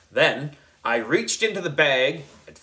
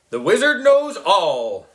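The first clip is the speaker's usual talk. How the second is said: higher, loud grand declaration; wizard voice; 6 semitones higher, 5 dB louder than usual. A narrator's reading. Boy wizard covering his mistake, loud grand wizard voice. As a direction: cover the slip with mystic grandeur. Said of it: "I covered my mistake".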